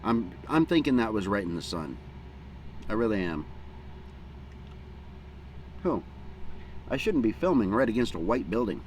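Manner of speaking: normal talking voice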